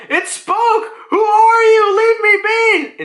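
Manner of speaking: high, loud mock shriek of panic; hammed-up freak-out, stretching the last words; York. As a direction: imitate someone screaming in terror. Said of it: "freaking out".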